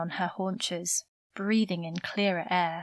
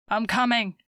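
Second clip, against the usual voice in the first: raised, loud urgent cry; screamed reply; 4 semitones higher, 6 dB louder than usual. A narrator's reading. Son answering his trapped father through fire, shouted back. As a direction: shout back across the noise. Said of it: "Max screamed back".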